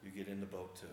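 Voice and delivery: low, soft and patient insistence; quietly but firmly send him along; gentle firm Jesus. Retold or imitated. Jesus telling Peter to get in the boat too, low and gentle. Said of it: "And you go".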